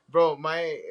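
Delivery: ordinary talk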